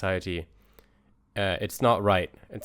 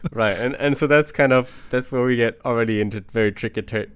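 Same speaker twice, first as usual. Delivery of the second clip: louder, higher, through leftover laughter; still laughing; 3 semitones higher, 7 dB louder than usual. Real, not acted. Moving the discussion on after the joke, still laughing. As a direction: talk on while still cracking up.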